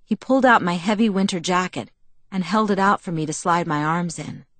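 plain reading voice

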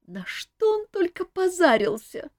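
put-on high girlish voice with a sob; jealous maid whimpering about the king; sobbing lightly. A narrator's reading. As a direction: choke it out, near tears. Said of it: "всхлипнула Селия".

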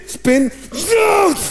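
brisk shout jumping very high on zoet; woman dismissing a spider. Retold or imitated. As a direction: shoo the spider off without fuss. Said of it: "Dan gebeurt er dit". (vrouw die zonder man een spin wegjaagt)

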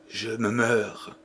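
dying voice. Acted. Dying wife to the priest, faint and slow. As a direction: breathe it out like a last breath. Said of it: "fit Rosalie".

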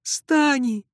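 high, loud tearful call of a name; tearful cry. Acted. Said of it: "со слезами бросилась". (плачущий высокий возглас)

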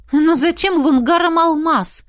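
high, loud baffled question; puzzled. A narrator's reading. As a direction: ask it genuinely baffled. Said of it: "недоумённо спросила я". (недоумённо)

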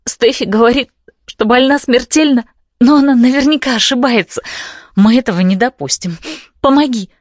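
high frantic pleading; frantic begging. Acted. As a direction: beg desperately, high pitched. Heroine begs frantically.

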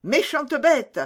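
high, loud scolding outburst; angry cry. A narrator's reading. Mother scolds her biting daughter, high loud angry cry. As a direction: snap at the biting brat. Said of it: "s'écria la mère".

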